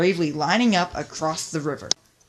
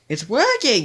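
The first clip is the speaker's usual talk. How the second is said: high, loud excited call; excited shout; 6 semitones higher, 5 dB louder than usual. A narrator's reading. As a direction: call out in triumph. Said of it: "shouted Top Hat".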